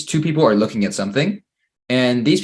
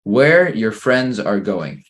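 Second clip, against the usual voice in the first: slow dictation; 3 dB louder, much slower than usual. Shown as a demonstration. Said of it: "Number four".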